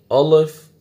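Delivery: الف with rounded, heavy vowels, like "awloif"; wrong alif. Shown as a demonstration. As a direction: demonstrate الف wrongly thickened. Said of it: "ایسے نہیں ادا کرنا". (غلط، موٹا الف)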